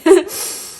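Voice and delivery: a short, high laugh; real laugh that bursts out; delighted laugh. Real, not acted. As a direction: laugh at the timing. Laughing after realising Mother's Day timing.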